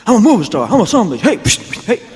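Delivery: high and loud boasting; cocky movie star. Retold or imitated. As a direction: strut it like a new movie star.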